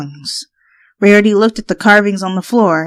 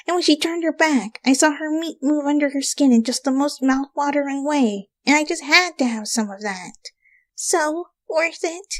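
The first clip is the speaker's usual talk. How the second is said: softer, savoring each word; dreamy confession; 8 semitones higher, 5 dB quieter than usual. A narrator's reading. Rainbow confesses to Twilight, still savoring the taste. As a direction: relish the memory.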